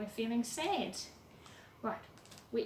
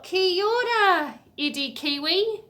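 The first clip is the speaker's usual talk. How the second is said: cheery greeting; 7 semitones higher, 8 dB louder than usual. Acted. Reading a greeting from the book.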